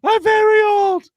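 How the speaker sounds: put-on very high, loud old lady squeal; man doing granny voice. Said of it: "old lady voice".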